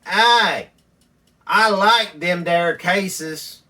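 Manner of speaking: loud, slow, high caricature of a delighted friend; imitating Earl. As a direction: drag it out like a simple country buddy. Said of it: "that's how he says things".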